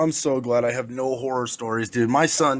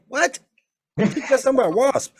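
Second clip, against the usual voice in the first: high, disbelieving mock retort; incredulous; 11 semitones higher than usual. Telling a scared kid it's only a wasp, in disbelief. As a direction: act amazed he's asking this.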